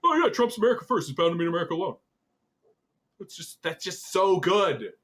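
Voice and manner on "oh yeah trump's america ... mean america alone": very fast, tossed-off imitation; breezy offhand quote. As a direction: rattle it off as if it were nothing. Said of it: "this guy comes along and says".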